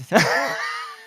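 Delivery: laugh. Real, not acted. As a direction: crack up at the silly word. Laughing at the mangled word psalmist.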